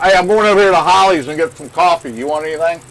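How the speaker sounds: loud, high call across the water. Real, not acted. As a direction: shout it over to the other boat. Calling across to another boat.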